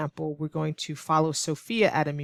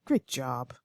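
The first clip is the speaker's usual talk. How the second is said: low, softer sneering praise; sarcastic; 4 semitones lower, 4 dB quieter than usual. Shown as a demonstration. Teacher showing a put-down, low sarcastic praise. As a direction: put the person down with dry sarcasm. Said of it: "It's a very negative tone".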